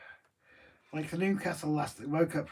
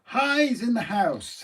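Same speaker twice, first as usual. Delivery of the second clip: drawn-out announcement; 8 semitones higher, 6 dB louder, much slower than usual. Acted. Announcing arrival.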